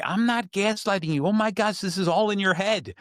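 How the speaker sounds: high, indignant protest as the accused; defensive denial. Acted. Accused partner answering a gaslighting charge, high defensive denial.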